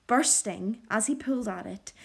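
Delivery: usual speaking voice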